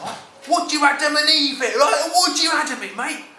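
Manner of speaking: cockney lad getting worked up; excited geezer. Acted. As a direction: pitch up and push it out, mate.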